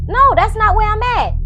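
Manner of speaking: high-pitched imitated denial; protesting. Tanisha refusing the doctor's news, mock protest.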